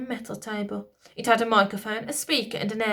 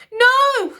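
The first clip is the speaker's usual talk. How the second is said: very high, loud scream; high loud royal scream; queen screaming; 12 dB louder than usual. A narrator's reading. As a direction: scream in desperate refusal. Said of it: "screamed the queen".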